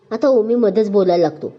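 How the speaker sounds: ordinary delivery, nothing marked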